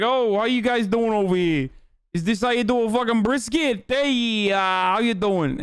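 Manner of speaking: put-on New York Italian accent. Italian New Yorker strolls into Texas, hammed-up New York Italian accent. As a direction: do a cartoonish New York Italian guy greeting the Texans. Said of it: "An Italian man from New York came down to Texas and was like".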